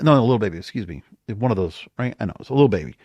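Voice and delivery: usual speaking voice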